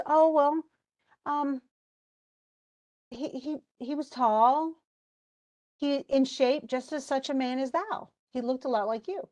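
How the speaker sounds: lightly acted reluctant answer, feeling for words; hesitant queen. Jocasta hesitantly describes Laius to Oedipus.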